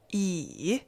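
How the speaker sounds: yi low, dipping then lifting; third tone. Shown as a demonstration. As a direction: drop yi low and dip it. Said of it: "Third tone".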